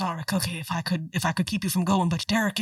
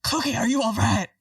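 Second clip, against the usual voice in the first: Will's voice pitched up and louder than usual; he's caught in a strange, raised voice and hears it himself; odd high voice; 4 semitones higher, 5 dB louder than usual. Acted. Will checking on Cookie, in an odd raised voice. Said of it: "Why am I talking like this?".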